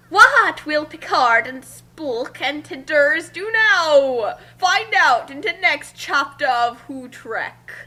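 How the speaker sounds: slow, teasing cliffhanger voice; trailer announcer. Acted. Announcing the next chapter to listeners, slow and teasing. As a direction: tease the next episode like a TV promo.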